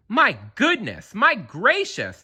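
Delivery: high, amazed crowd calling out; excited crowd shout. A narrator's reading. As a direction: cry it out in wonder. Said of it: "they shouted".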